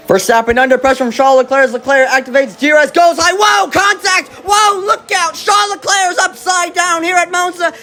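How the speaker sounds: high, shouted crash call; motorsport commentator. Acted. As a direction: call the flip live, all excitement. Live crash call, play-by-play.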